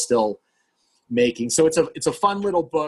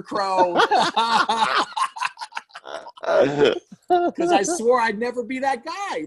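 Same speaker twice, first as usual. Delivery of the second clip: laughing; 12 semitones higher, 3 dB louder, much slower than usual. Real, not acted. Laughing at his own failure.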